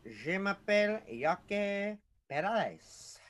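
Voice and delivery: high, slow, hammed-up French; exaggerated French Canadian introduction; mock French accent. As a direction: croon it like a cartoon Frenchman. Echoes partner's French introduction.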